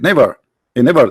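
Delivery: normal talking voice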